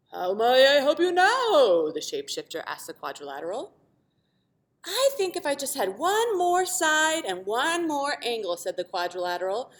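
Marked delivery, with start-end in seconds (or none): 0.1-1.9 slow, loud, oddly timbred magic voice; shapeshifter voice. Acted. 4.8-8.5 hopeful quadrilateral. Acted. Quadrilateral asks.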